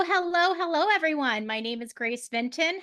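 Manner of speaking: ordinary talk, nothing marked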